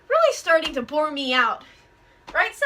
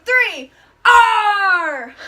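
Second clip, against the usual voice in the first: loud, higher roared pirate R; mean pirate roar; 5 semitones higher, 9 dB louder than usual. Shown as a demonstration. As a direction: roar the R as mean as you can. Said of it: "give me your biggest, baddest, meanest R".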